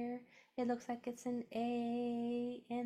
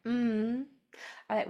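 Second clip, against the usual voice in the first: ordinary delivery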